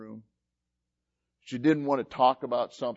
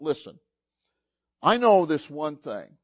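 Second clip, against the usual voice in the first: ordinary talk, nothing marked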